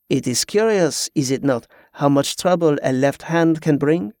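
put-on French-accented, veiled warning; hint slyly as a French pirate. Pirate locks eyes with Tezca, veiled French-accented warning.

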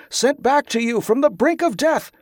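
high, ringing proclamation; calling out. A narrator's reading. Tezcah addressing the mob of pilgrims, calling out high. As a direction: proclaim it high over a whole crowd. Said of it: "Tezcah called".

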